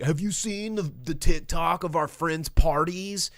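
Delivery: high, excited, mocking teen voice; teen movie kid. Kid showing a friend a video, mock gushing. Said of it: "one of them's like".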